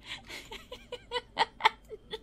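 high, soft giggle fit; real breathless giggling; laughing. Real, not acted. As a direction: dissolve into helpless high giggles. Cracks up mid-sentence.

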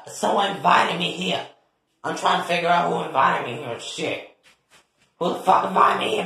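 usual speaking voice

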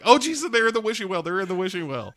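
high, fast, frantic confession; panicked blurt. Retold or imitated. Crystal blurting out the keys in a frantic panic. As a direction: spill the secret in a rush of panic.

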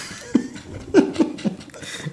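high, breathless giggle; long squeaky fit of laughter; real giggling. Real, not acted. Cracking up at a joke about the accent, high giggle.

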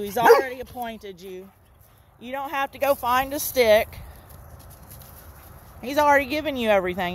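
normal talking voice

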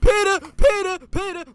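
calling out. Real, not acted. Calling out for Peter.

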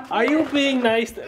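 the question breaks up into real laughter; laughing. Real, not acted. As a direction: start the line and lose it to a laugh. Dad breaks into laughter mid-question.

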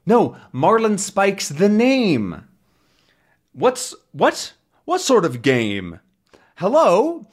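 high, slow, urgent phone call; a young reporter trying to make himself heard; young hero on phone. Acted. Voicing Tintin and captain.

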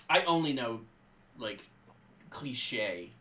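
ordinary talk, nothing marked

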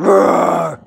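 one long mock-fierce roar; playful roar. Owner play-fighting with the dog over a toy, playful roar. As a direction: let out a pretend beast roar.

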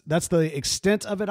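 ordinary talk

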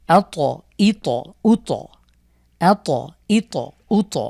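আত, ইত, উত, each ত bounced; qalqalah drill. Shown as a demonstration. Teacher reading the drill with students, bouncing each ত. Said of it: "একবার মিলিয়ে পড়ব আমরা".

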